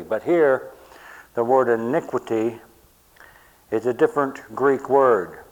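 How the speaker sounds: loud, driving sermon delivery; full-force preaching. Acted. Pastor hammering home the Greek meaning to the church. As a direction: press every point home from the pulpit.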